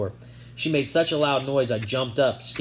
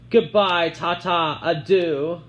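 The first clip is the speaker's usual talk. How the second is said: mocking farewell; 6 semitones higher, 5 dB louder, much slower than usual. A narrator's reading. Sissy calling out.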